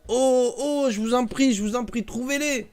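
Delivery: high, anguished begging; the cousin begs the narrator for money, anguished and high; pleading cry. Acted. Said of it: "poussa une sorte de cri de souffrance".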